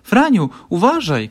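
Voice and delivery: high, loud warning cry; sudden shout. A narrator's reading. Malwinka shouts to Franio about the puddle, loud and high. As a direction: yell a warning. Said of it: "krzyknęła nagle Malwinka".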